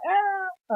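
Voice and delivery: high, soft held "ah" scream; mock scream. Film fan copying her screaming, high restrained mock scream. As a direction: imitate a scream without the volume. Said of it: "her screaming".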